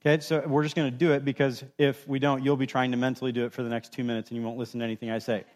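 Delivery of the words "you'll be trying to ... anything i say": usual speaking voice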